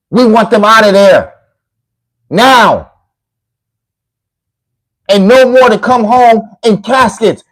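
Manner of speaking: loud, high, furious ranting at the fans. Real, not acted. Ranting at so-called Trump fans on the livestream, furious and loud. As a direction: yell the outrage at them, rising.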